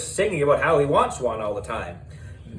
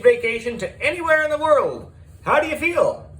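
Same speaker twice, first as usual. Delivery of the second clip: rising game show host pitch; excited host; 6 semitones higher than usual. Acted. Miss Beard building excitement announcing the prize.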